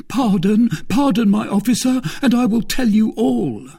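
high, loud begging bandit; crying out. A narrator's reading. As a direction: plead frantically for pardon. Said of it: "cried the bandit".

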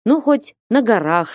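usual speaking voice

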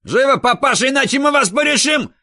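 high frantic yell; yelling like a maniac. A narrator's reading. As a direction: scream it like a madman. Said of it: "завопил как ненормальный".

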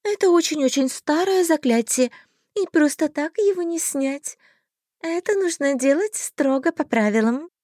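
high, sweet fairy voice; pixie. A narrator's reading. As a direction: explain the spell like a fairy. Fairy explains.

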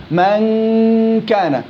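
من كان with a long nasal n; slow nasal drill; long ghunna. Shown as a demonstration. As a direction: hold the hum then close on ك. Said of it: "في غنة للنون".

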